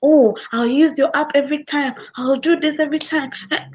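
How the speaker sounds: high, eager promises; delighted customer. Retold or imitated. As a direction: gush like a won-over customer. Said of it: "he's now nice".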